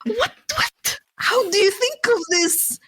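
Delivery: high, whiny, baffled put-on voice; imitating the rejected guy's outrage; mock bewildered suitor. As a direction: squeak out his confused protest. Mimicking the guy's confused outrage.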